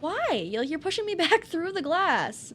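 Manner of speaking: high-pitched protest; exasperated whine. Real, not acted. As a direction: complain to the game in disbelief. Protests being pushed back.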